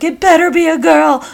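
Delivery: high, excited voice of a sister placing her bet; insistent sister. Retold or imitated. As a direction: imitate a sister insisting, pitched well up. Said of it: "My sister's like".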